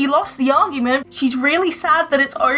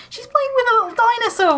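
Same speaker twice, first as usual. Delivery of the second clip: cooing delight; 11 semitones higher, faster than usual. Real, not acted. Cooing over the toddler.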